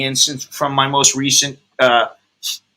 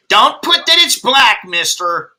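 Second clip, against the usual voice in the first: passive-aggressive clerk; 7 semitones higher than usual. Retold or imitated. Imitating the DMV clerk correcting his hair colour, passive-aggressive. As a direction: play the DMV clerk scolding him, sweetly snide. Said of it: "That was her passive-aggressive way".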